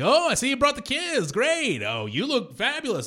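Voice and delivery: high, effusive host greeting guests; glad-handing owner. Retold or imitated. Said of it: "he is glad-handing everybody".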